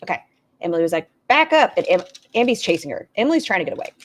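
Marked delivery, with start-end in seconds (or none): 1.3-1.7 high, loud imitated shout to back off; alarmed warning. Emily fending off a pursuer, alarmed shout. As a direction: call it out sharply as someone being chased. Acting out Emily being chased.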